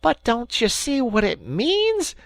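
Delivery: high, loud exasperated Rat; impatient cry. Acted. Rat scolding dull-witted Mole, impatient. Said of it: "cried the Rat impatiently".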